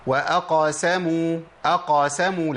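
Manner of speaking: وأقاسموا with the qaf opened like a fatha; common mistake. Shown as a demonstration. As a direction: imitate the wrong open qaf in وأقاسموا. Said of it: "لا".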